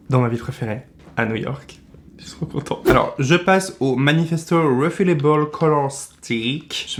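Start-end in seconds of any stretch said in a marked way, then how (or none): 2.2-2.8 whispered, delighted aside; excited whisper. Real, not acted. Vlogger whispering to viewers about his birthday trip. 2.9-7.0 slow, careful English product name with a heavy French accent. Real, not acted. Vlogger reading the English product name with his heavy French accent. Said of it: "Mon accent est infernal".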